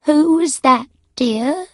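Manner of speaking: high-pitched mother calling from another room; weak elderly mother. A narrator's reading. Mother calls to her daughter from the next room, weakly. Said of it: "mother's voice called weakly".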